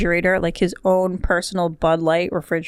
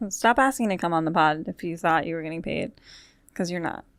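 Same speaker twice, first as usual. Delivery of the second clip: ordinary delivery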